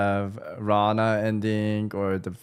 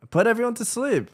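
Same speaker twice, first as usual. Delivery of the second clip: pitched way up, mocking the plan; squeaky sarcastic decree; high mock villain voice; 10 semitones higher, faster than usual.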